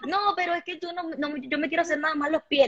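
playing the client who only wants her feet done; voicing a fussy client.

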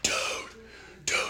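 hushed, voiceless mock scream; whispered imitation of yelling. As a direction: scream it under the breath. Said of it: "I'm screaming his fucking name".

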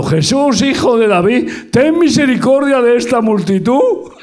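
high, loud pleading shout; shouting in the street. Pretend. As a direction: call out to the crowded street for mercy.

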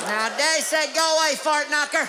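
high hammy voice. Acted. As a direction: play it like a country kid shouting. Acted door scene, high voice.

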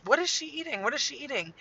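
high, panicked mock-mom questioning; freaking out. Mom hears trouble in the back seat, high-pitched panic. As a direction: re-enact a mother's alarmed double question. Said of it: "I'm, like, freaking out".

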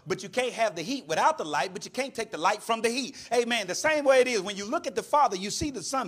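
ordinary talk

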